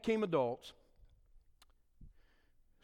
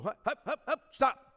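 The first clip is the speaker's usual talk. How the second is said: dad cutting him off; 8 semitones higher, 6 dB louder than usual. Retold or imitated.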